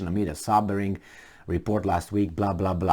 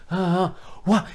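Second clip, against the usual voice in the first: a far-off, puzzled "what" that rises; mock confused; 8 semitones higher than usual. Mimicking a clueless pundit asking what, puzzled. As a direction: play dumb, baffled.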